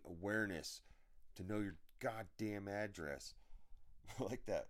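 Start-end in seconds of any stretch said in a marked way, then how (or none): 3.4-4.1 quiet breathy chuckle; short chuckle. Real, not acted. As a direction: chuckle under your breath. Amused at own rant.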